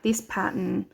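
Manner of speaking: ordinary talk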